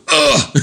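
comic-book oof of pain; punched grunt. Acted. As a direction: grunt as if hit in the stomach. Chrissy takes a punch.

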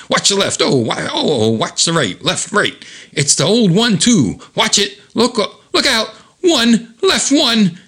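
high, excited calling of punches; playful comic-book fight chatter; fighter's taunting patter. Acted. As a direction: call out each punch in a high voice. Voices Kevin during a fist fight.

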